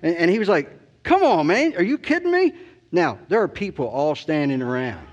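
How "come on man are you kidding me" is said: much higher, incredulous come on man; pitched-up teasing disbelief; mock disbelief. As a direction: play up the chaplain's fake amazement to needle him. Chaplain mocking him in public.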